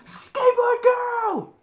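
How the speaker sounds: very high, drawn-out acted shout; desperate long-drawn yell; top-of-lungs scream. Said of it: "he's screaming at the top of his lungs".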